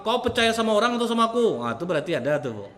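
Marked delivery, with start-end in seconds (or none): 0.1-1.6 higher, louder, fast accusation; husband deflecting his wife's question, loud and fast; defensive husband. Retold or imitated. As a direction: turn it back on her fast. Husband defensively turns on wife.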